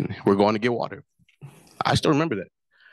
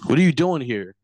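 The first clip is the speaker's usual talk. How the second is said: higher, louder police questioning; retold officer demanding an answer; retold officer's challenge; 5 semitones higher, 6 dB louder than usual. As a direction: challenge them like a suspicious cop. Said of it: "this officer walks up to me and he's like".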